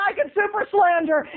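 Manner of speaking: high, self-righteous retort; hammed-up indignant boy; indignant kid. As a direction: declare it with mock righteous defiance. Retold kid's defiant threat.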